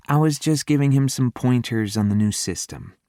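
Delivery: a hint of a sassy girl; lightly voiced young woman. A narrator's reading. Female character voiced higher.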